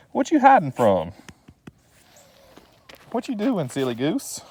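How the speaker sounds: playful cooing at a goofy dog. Real, not acted. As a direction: tease the dog in a cooing high voice. Baby talk to the dog.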